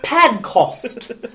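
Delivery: high, drawn-out mock British; British accent. Shown as a demonstration. Host echoing co-host, overdone British accent. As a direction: exaggerate the British vowels in "Podcast". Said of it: "A British accent".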